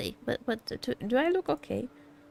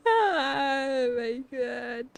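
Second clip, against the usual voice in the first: breathless laughing cry, very slow; laugh-cry the words out, overwhelmed; teary laugh; much slower than usual. Real, not acted. Overwhelmed by handsome game character.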